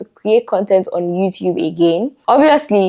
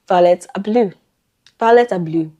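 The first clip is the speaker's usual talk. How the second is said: ordinary talk, nothing marked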